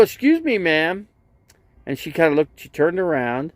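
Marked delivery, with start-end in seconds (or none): 0.0-1.1 high, polite call to get her attention; a lifted excuse-me to a stranger; politely calling out. Retold or imitated. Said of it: "I go".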